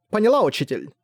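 sweetly feigned obedience, high; mock obedient. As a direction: act the good girl. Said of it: "прикинулась послушной".

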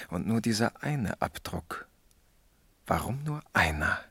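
whispered, thinking aloud; whispered musing over the evidence. Acted. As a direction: hush it as if sharing a secret. Detectives pondering a clue.

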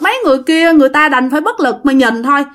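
usual speaking voice

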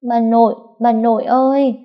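put-on high, tearful little boy voice; whimpering child. A narrator's reading. Small boy clinging to his grandmother, whimpering. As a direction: call out for grandma, upset and high. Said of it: "thút thít".

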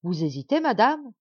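dwarf crying out at the hesitant queen; shouted question. A narrator's reading. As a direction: shout at her to hurry her answer. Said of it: "lui cria-t-il".

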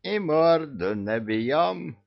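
high, slow demanding villain; Koschei demanding. A narrator's reading. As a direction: insist like a petty old villain. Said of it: "потребовал Кощей". (Кощей требует)

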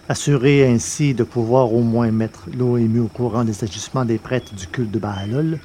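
ordinary delivery

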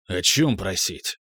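low, slow gloomy asking; gloomy question. A narrator's reading. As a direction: frown through the question. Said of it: "хмуро поинтересовался Тирил". (хмурый вопрос)

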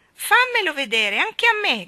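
put-on high, loud clamouring children; shouting twins. A narrator's reading. Twins beg to see, shouting. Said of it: "gridarono i gemelli".

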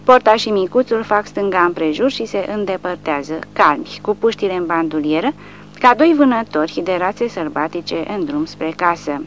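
plain reading voice

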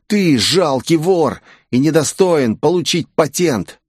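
indignant, drawn-out growl; growled anger. A narrator's reading. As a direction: spit out the accusation. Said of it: "прорычал темноволосый".

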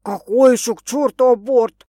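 loud, baffled outburst; loudly incredulous; indignant bafflement. A narrator's reading. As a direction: blurt it out, thrown by the news. Man reacts baffled to the news.

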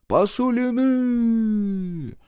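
high, long-held chanted cry; crowd response. A narrator's reading. Crowd echoes the leader, high drawn-out cry. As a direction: hold the word high like a crowd. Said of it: "гаркнула в торе ему толпа".

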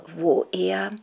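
usual speaking voice

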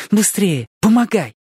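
plain reading voice

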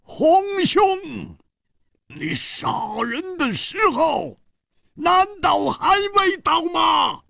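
very high, strained cry; exasperated plea. Acted. Song Gang urging the killer to strike, exasperated. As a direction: burst out high, stretching the words. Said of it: "终于忍不住说".

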